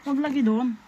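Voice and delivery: high, loud warning call; telling the bird off from a distance, loudly. Real, not acted. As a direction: call out to stop the bird going there. Calling to hiding bird.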